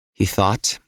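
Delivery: usual speaking voice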